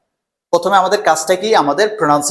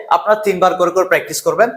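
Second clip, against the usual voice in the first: usual speaking voice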